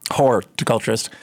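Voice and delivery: "Horticulturist" with the first syllable stressed. Shown as a demonstration. Finishing host's joke about the class title, first syllable stressed in "Horticulturist". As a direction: lean on the "Hor" in "Horticulturist". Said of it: "Emphasis on the".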